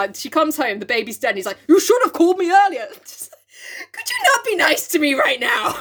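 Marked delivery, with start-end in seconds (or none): 1.7-2.9 high, loud hammed-up rebuke; mock scolding. Doctor blaming the bereaved mother, mock angry. 3.9-5.8 high, hammed-up wounded protest; mock upset plea. Bereaved mother answering the doctor, mock upset.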